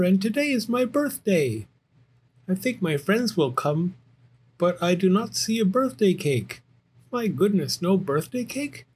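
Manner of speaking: high little bear cub, fretting then planning; worried Little Bear. Acted. Little Bear without a birthday cake, high and earnest. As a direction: play a young bear working out a problem.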